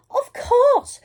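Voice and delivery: high, bright eager cry; girl eagerly agreeing, high and excited. A narrator's reading. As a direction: chirp it out with glee. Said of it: "cried Little Red".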